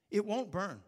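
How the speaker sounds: a building, forceful preacher's cry; rising to a full-voiced warning; preaching loud. Real, not acted. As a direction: hammer the danger home.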